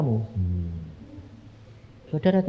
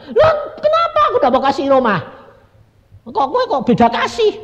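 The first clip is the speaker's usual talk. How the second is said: put-on high, loud childish complaint; whining protest; 12 semitones higher, 5 dB louder than usual. Asking God why no house is given, whining protest. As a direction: protest like a child treated unfairly. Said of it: "pokoknya ngerengek terus".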